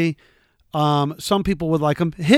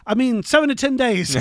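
higher, lightly put-on correcting voice; imitating the designer; 8 semitones higher than usual. Retelling the correction, a light mock voice. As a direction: replay his quick correction, pitched up. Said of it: "He goes".

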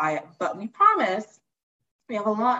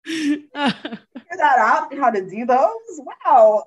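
laughing; 7 semitones higher, 4 dB louder than usual. Retold or imitated. Laughing at tax meeting.

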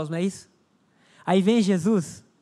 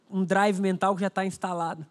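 ordinary delivery

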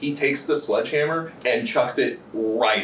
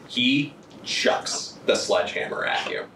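slow, hushed suspense narration; dramatic narration; 2 semitones lower, slower than usual. Acted. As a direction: draw out each beat for tension. GM narrates tense scene.

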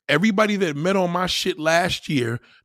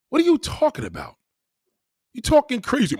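usual speaking voice

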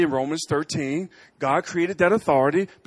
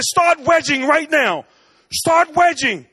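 urgent command; 10 semitones higher, 6 dB louder, slower than usual. Retold or imitated. Father instructs son on slope.

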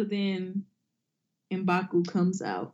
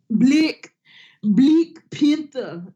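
Bleak Panther with flattened vowels, loud and slow; mock South African accent; 4 semitones higher, 6 dB louder, much slower than usual.

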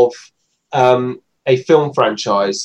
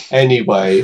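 ordinary talk

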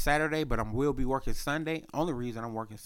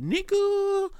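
much higher, mock exclaim; high pitched slang. Imitating the new iPhone, high squeaky exclaim. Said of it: "They on that".

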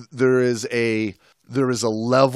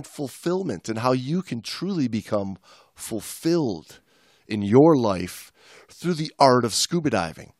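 announcer voice; 4 semitones higher than usual. Acted. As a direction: sign off like a radio host. Mock announcer outro.

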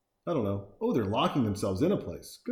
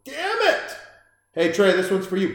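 mock startled outburst; 6 semitones higher, 4 dB louder than usual. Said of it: "He's like".

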